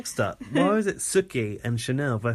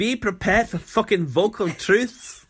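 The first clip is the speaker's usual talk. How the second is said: put-on slow, higher Scottish accent, louder; 6 semitones higher, 4 dB louder, slower than usual. Doing Andrew's line on request, slow Scottish accent. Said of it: "I wanna hear that in the Scottish accent".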